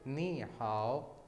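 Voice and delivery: नी हाओ slowly, नी rising; Chinese ni hao. Shown as a demonstration. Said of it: "उसको कैसे hello कहेंगे". (नी हाओ धीमे, उठते नी के साथ)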